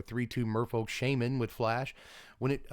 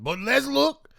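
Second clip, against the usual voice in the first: put-on much higher, louder, slow made-up Creole-ish accent; 7 semitones higher, 6 dB louder, slower than usual. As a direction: ham up a fake Creole accent. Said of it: "is it, like, Creole?".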